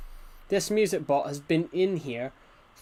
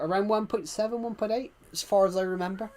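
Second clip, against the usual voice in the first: normal talking voice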